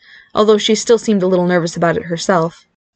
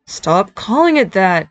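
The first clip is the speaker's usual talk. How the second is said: slow, groaning complaint; drawn-out, long-suffering whine; moaning; slower than usual. A narrator's reading. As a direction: moan it in exasperation. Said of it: "Barry moaned in his mind".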